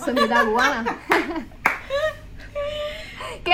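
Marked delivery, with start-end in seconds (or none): 1.0-3.4 high, breathy laugh. Real, not acted. Teased by friends, she laughs.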